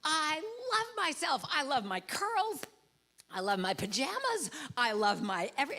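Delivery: lightly voiced little girl at the mirror; little girl's affirmation. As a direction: play a small girl delighting in herself. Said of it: "little girl who gets in front of the mirror and she goes".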